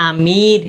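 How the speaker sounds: আমির drawn out slowly on the ee; long ee. Shown as a demonstration. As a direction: stretch the second vowel of আমির into a clear ee. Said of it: "ই এরকম একটা উচ্চারণ হবে".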